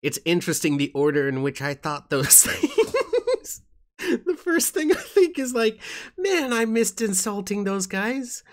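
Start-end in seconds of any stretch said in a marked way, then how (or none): 2.1-8.4 high, squeaky talk through laughter; cracking up while talking; laughing. Real, not acted. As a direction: laugh your way through the words, pitch climbing. Laughing at own word order.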